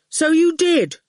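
put-on cheeky boy brushing off a giant; young boy. A narrator's reading.

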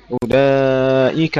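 أولئك with the joined madd stretched long; longer madd. Shown as a demonstration. Teacher shows the student a stretched أولئك. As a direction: draw out the long vowel of أولئك. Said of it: "مد المتصل أكثر".

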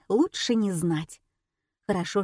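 ordinary delivery

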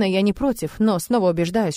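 plain reading voice

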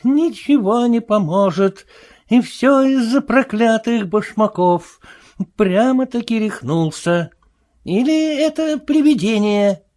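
high-pitched lament of a bewildered tramp; despairing tramp. Acted. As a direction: wail mildly, convinced you have gone mad. Марвел в отчаянии причитает. (причитания растерянного бродяги высоким голосом)

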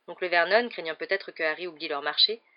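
usual speaking voice

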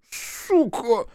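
high, strained hissed curse; pained hiss. A narrator's reading. Policeman with his arm twisted behind him, hissing a curse. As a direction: hiss it through the pain. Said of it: "зашипел он".

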